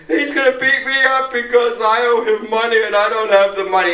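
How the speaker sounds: put-on high-pitched, pleading kid; imitated distressed plea. Joey explaining the threat to Marcia, imitated distressed plea. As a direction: pour it out like a scared youngster.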